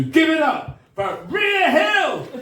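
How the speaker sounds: slow, loud, high build-up to the name; big host introduction, stretched and shouted; announcer shout. Real, not acted. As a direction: call it out to the back of the room. Host calls audience to applaud.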